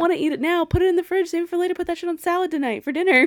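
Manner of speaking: high, loud, fast pleading; urging Andy to save the steak; excited advice. Real, not acted. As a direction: rush the tips out eagerly. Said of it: "excited advice".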